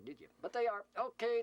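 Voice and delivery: ordinary delivery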